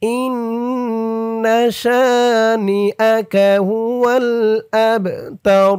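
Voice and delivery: slow melodic tajweed chant; Quran recitation. Shown as a demonstration. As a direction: draw out every vowel in recitation. Recites Quran verse slowly.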